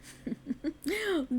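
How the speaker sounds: a short knowing laugh that falls away; amused laugh. Acted. Fae ruler laughs knowingly at the mortal, amused.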